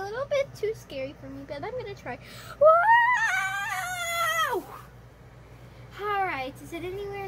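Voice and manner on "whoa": long high frightened whoa; scared yelp. Real, not acted. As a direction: cry out in fright. Scared of heights, slipping.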